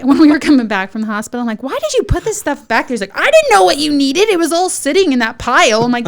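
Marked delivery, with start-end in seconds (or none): 1.6-2.9 high, incredulous retold question; mock scolding, pitched up; exasperated demand. Wife questioning husband about packing, exasperated. Retold quarrel over the gift bags. 3.2-5.8 high, loud mock defense; defensive protest. Husband defending what he packed, defensive.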